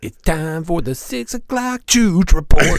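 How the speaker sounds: high, slow, booming announcer voice. Retold or imitated. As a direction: announce it like a TV anchor. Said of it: "I was telling Kate about the Chooch Report".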